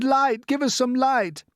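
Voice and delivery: high, loud, slow plea; cry out. Acted. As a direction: beg loudly for light. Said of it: "they cried".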